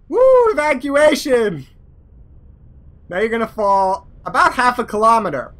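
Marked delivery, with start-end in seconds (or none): 0.1-1.7 high, drawn-out whoop; excited cheer. Real, not acted. Gamer celebrates his pilot bailing out, excited cheer. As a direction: cheer it slowly, pitched way up.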